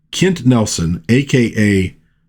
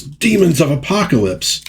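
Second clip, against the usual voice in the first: mock scream; 7 semitones higher than usual. Barda outside cursing in frustration, high mock scream. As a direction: act out a scream without the volume. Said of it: "she gets outside and screams".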